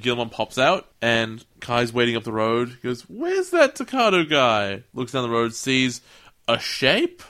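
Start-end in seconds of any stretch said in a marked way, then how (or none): 3.1-4.8 wondering aloud. Retold or imitated. Kai waits up the road.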